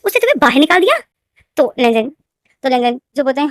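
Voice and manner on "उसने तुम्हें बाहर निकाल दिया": high, louder disbelieving question; shocked question. Acted. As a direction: she can't believe her son was thrown out. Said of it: "shock में हो जाती है". (चौंककर, ऊँची आवाज़ में सवाल)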